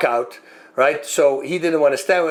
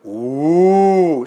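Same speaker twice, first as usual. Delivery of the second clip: a loud, drawn-out ooh from the crowd; 4 dB louder than usual. Retold or imitated. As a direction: imitate the whole arena gasping ooh. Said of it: "I hear the whole audience going".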